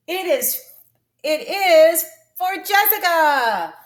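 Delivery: loud, high, slow "it is", then "for Jessica" drawn out; excited, with a break. Shown as a demonstration. Teacher contrasting the long form, loud excited phrasing. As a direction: exclaim "it is / for Jessica" in two big groups. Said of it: "no room in this to take a break".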